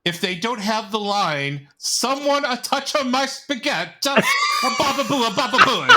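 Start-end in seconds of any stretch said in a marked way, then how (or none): 1.8-5.9 high, fast, hammed-up fake Italian accent. Mocking how Chris Pratt might voice Mario, fake Italian accent. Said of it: "fake Italian accent".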